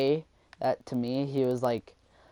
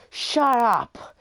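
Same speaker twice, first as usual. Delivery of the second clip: high, loud mimicked outburst; mock-angry shriek, sharply raised; imitated angry yell; 11 semitones higher, 7 dB louder than usual. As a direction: mimic a furious classmate yelling. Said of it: "angry as shit now".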